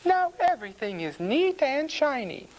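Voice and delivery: high, satisfied housewife voice; farmer's wife. A narrator's reading. Farmer's wife after washing the pig, satisfied. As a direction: pitch it high, pleased with the cleaning. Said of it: "says the farmer's wife".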